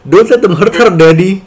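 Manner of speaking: put-on high pleading child. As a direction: beg like a scared little girl. Child's plea in retold comic. (suara anak kecil memohon)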